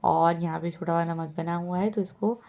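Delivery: ordinary talk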